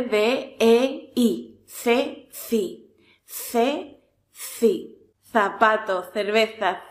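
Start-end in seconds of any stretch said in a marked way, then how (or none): 1.7-5.0 ce, ci with a lisped th; tongue between teeth for ce, ci; th syllables. Shown as a demonstration. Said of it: "Y para la C". (ce, ci con zeta castellana)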